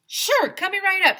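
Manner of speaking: high, loud, eager vendor voice; chirpy ice cream man. Acted. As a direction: sing it out like a too-cheerful ice cream man. Cheerful new ice cream man.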